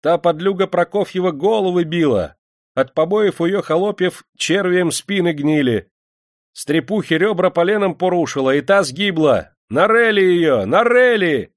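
high, indignant outcry building to shouts; angry crowd accusing. A narrator's reading. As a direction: accuse in a high voice, then yell for punishment. Народ обвиняет барыню, требует казни. (возмущённые крики народа)

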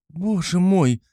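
high-pitched exclamation of dismay; shocked cry. A narrator's reading. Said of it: "вскричала Пульхерия Александровна".

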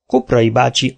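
ordinary delivery

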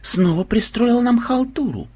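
mildly accusing, rising at the end; understated suspicion; stern questioning. A narrator's reading. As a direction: question like a strict superior. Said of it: "строго спросил он".

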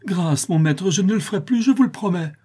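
put-on boy pleading with his master near the monkey; terrified plea. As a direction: beg for mercy, shaking. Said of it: "criait-il".

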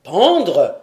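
sudden loud angry exclamation; angry outburst. A narrator's reading. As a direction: flare up at the word. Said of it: "s'écria Glenarvan, dominé par un brusque mouvement de colère".

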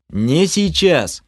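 slow, high, sharp snap; snapped. A narrator's reading. Mage snaps at chatty elf mid-battle, impatient. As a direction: cut him off impatiently. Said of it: "рявкнул я".

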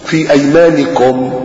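slow, chanted recitation; Quran recitation. Acted. Preacher recites verse slowly to congregation. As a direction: intone the verse slowly and reverently.

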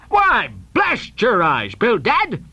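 high-pitched, drawn-out outburst; blustering, outraged old captain; indignant cry. Acted. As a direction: curse your partner in exasperation. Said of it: "cried Peleg".

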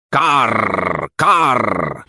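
long rolled crow caw; falling, drawn-out "karr". A narrator's reading. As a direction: caw twice like a crow, trilling the R. Crow caws in the story.

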